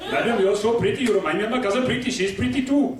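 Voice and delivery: put-on comic Indian accent, pitch climbing. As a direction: flatter her in a singsong Indian accent. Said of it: "if you're Indian".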